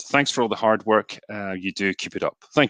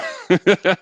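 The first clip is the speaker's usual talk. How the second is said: a quick real laugh, higher than usual; short amused laugh; 9 semitones higher, 3 dB louder than usual. Real, not acted. Streamer laughing as donations keep pouring in, amused laugh.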